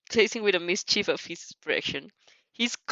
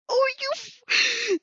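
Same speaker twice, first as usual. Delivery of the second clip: excited squeal; much slower than usual. Real, not acted. Flustered fan reaction.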